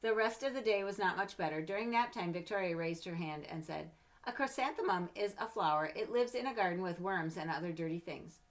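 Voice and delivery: storybook narration, gentle and lively; reading to children. Acted. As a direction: read it like a bedtime picture book. Reads picture book aloud.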